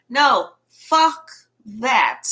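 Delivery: drawn-out defiant dismissal; emphatic refusal. Real, not acted. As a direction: spit out a slow, firm refusal. Rejecting the polite version.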